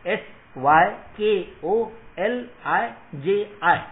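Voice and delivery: each letter spoken slowly, rising and loud; slow spelled out. Shown as a demonstration. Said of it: "मैं spelling आपको बताता हूं". (धीरे-धीरे, ज़ोर से एक-एक अक्षर बोलते हुए)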